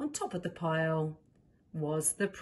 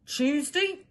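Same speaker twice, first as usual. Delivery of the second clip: king's voice; 7 semitones higher, 4 dB louder than usual. Acted. King's decree in story.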